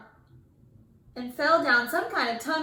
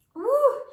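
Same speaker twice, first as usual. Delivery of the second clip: high relieved whew; 13 semitones higher, 7 dB louder than usual. A narrator's reading. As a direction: blow out relief. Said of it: "said Phoebe".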